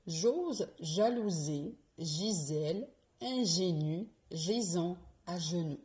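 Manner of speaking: "J'ose jalouser" slowly, J hushed and voiced; slow Z and J drill. Shown as a demonstration. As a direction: lean into every J. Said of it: "La troisième". (virelangue lente, J bien appuyé)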